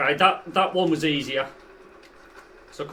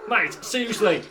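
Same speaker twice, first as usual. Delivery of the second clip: ordinary talk, nothing marked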